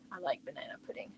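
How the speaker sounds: quick whispered confession; fast hushed aside. Real, not acted. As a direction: whisper it like a guilty secret. Quiet confession about dessert.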